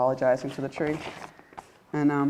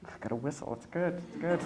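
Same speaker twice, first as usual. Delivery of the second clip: ordinary talk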